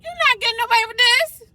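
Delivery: high, loud taunting shout; defiant yell. Acted. Acting out her inner bully, loud and high taunting. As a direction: fling it out as a challenge.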